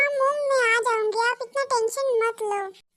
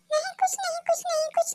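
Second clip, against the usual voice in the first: flustered denial; 6 semitones higher, faster than usual. Acted. Caught grumbling, denies quickly.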